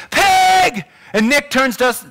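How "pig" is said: a high, louder mock yell out the truck window; hammed-up trucker shouting an insult; retold yell. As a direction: fling the insult like the passing driver did. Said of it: "He yells".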